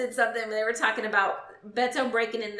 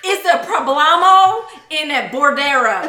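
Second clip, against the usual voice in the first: much louder, slow fake Spanish accent; mock Spanish accent; 3 semitones higher, 11 dB louder than usual. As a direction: roll it out like bad seventh grade Spanish. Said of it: "the best Spanish accent".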